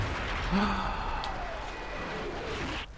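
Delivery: long, high, soft gasp; drawn-out breathy gasp of shock. Real, not acted. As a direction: gasp in dismay and let it tail away. Shock at losing the soldier.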